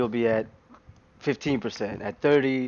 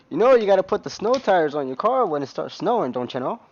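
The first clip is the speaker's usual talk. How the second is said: higher, louder mock Minnesota accent; 7 semitones higher, 5 dB louder than usual. Imitating a Fargo local about snow tires, hammed-up Minnesota accent. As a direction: play a folksy Minnesotan giving advice in that accent. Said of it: "they have their accent".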